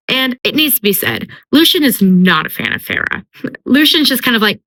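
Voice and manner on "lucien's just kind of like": ordinary delivery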